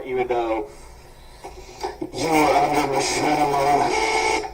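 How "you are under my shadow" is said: ominous villain. Acted. Ominous taunt.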